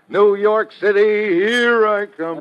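high, loud and slow, savoring every word; gleeful exclamation. Acted. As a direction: announce the trip like a prize won. Steve gleeful about vacation.

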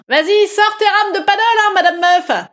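high, mocking jab; mock-indignant heckler jeer; taunting heckler. Heckler figure taunts Madame Meuf.

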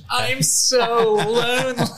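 slow, laughing through the words. Real, not acted. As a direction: laugh it out, mock-pitiful. Laughing at own sad joke.

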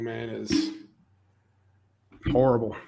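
ordinary delivery

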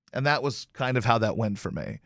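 normal talking voice

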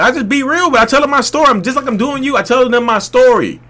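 high-pitched, emphatic retelling; animated insistence. Retold or imitated. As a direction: push the pitch right up while insisting. Quoted eager reply, worked up.

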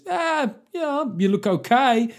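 high-pitched, hesitant fake approval; unconvincing reassurance. Husband answering wife about her new jeans, high unconvincing reassurance. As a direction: pitch it way up, as if not meaning it.

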